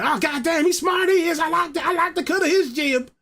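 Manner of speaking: high shouted mock praise; impressed onlookers. Crowd gushing over a clever streamer, high mock shout. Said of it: "Everyone's like".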